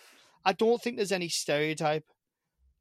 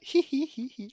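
mock giggle; 9 semitones higher than usual. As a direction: mimic Americans giggling at an accent. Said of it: "all you Americans will be like".

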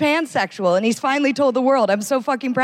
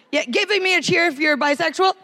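crowd call; 5 semitones higher than usual. Real, not acted. Calling to audience.

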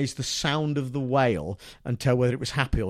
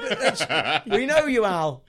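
normal talking voice

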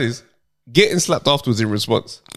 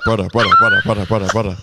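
inner voice urging; 3 semitones lower than usual. Retold or imitated. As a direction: chant it like an inner voice pushing him. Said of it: "the voice in his head".

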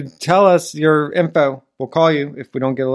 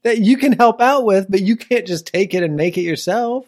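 hammed-up fury over a pie; mock outrage; 10 semitones higher, 5 dB louder than usual.

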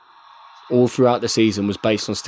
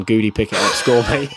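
ordinary talk

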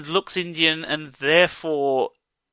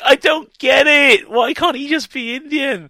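high, pleading protest, louder at first; exasperated; 9 semitones higher, 4 dB louder, slower than usual. Real, not acted. Podcaster protesting to co-host about Naveen's race, exasperated. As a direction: let the bafflement burst out, pitched high.

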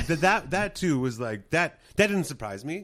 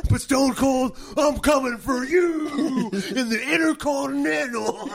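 high, loud, slow wrestler callout; heroic rallying shout; 11 semitones higher, 7 dB louder than usual. Pretend. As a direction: challenge a rival like a pro wrestler. Continuing the wrestling promo bit.

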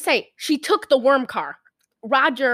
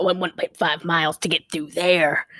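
bus driver impression; 7 semitones lower than usual. Acting out bus driver's directions.

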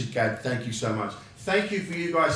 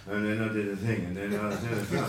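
"And then I did a thing" in one level pitch, no highs or lows; monotone drone; 4 semitones lower than usual. Shown as a demonstration. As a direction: drone it out like a dying comic. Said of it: "there's no highs or lows in your accent".

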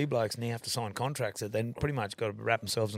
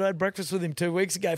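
higher-pitched insistence; acted protest; 7 semitones higher, 4 dB louder than usual. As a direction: retell yourself protesting to a doubting friend. Protests to disbelieving friend, acted.

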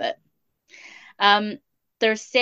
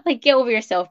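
louder, scoffing get over yourself; dismissive retort; 4 semitones higher, 8 dB louder than usual. Retold or imitated. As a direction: brush him off with scorn.

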